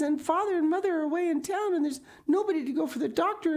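high, breathless rush of fear, loud; panicked. A narrator's reading. Diana telling Anne her sister is sick, panicked and high. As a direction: tumble out the bad news in a frightened rush.

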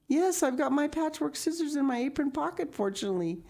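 put-on very high, fast girlish reply; Anne, briskly practical. Anne has scissors in her apron pocket, brisk high voice. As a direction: chirp it out quickly.

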